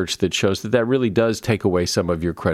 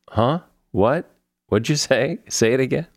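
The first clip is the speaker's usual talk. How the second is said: higher-pitched, questioning repeat requests; not catching it; 5 semitones higher than usual. Acted. Host mimicking people asking others to repeat themselves, puzzled. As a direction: lift each one like you didn't hear.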